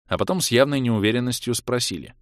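plain reading voice

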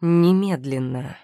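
slow, weighted command; calm menace. A narrator's reading. Демиар presses Виллиан to let go, calmly threatening. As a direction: make one word a warning. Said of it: "в нём чувствовалась угроза".

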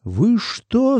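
high-pitched indignant exclamation; outraged cry. A narrator's reading. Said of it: "закричал Артём".

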